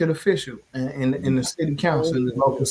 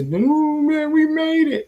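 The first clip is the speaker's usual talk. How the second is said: high, bright cry of victory, much higher than his talk; joyful cheer; 11 semitones higher, slower than usual. Retold or imitated. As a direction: whoop it out like the win just came in. Election night joy retold.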